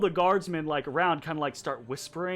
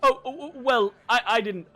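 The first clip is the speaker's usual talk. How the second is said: high, tripping over every word; flustered stammering; 5 semitones higher, 4 dB louder, faster than usual. Acted. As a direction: stammer nervously to a superior. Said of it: "quit your stammering".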